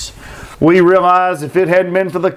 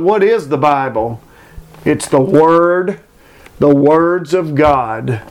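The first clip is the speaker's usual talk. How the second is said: ordinary talk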